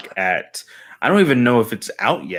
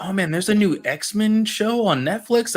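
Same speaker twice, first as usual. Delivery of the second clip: mock excitement; 9 semitones higher, slower than usual. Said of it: "I was like".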